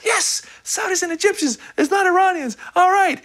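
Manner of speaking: very high, squeaky celebrating voice; little inner voice. Retold or imitated. Inner voice celebrating the news, very high and squeaky. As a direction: cheer like a tiny voice in your head. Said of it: "the little voice in the back of my head".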